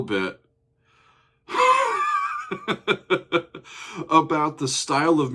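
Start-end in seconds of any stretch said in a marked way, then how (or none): 1.5-4.1 high laugh. Real, not acted. Laughing mid-sentence.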